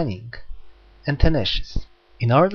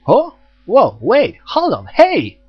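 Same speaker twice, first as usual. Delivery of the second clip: high, louder startled call; alarmed calling out; 10 semitones higher, 7 dB louder, slower than usual. Acted. Hyoma calling after Jack, startled shout. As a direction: shout after her to stop.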